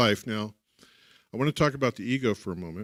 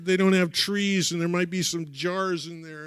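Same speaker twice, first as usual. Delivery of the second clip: put-on child's refusal; 7 semitones higher, 6 dB louder than usual. Child refusing zoo trip.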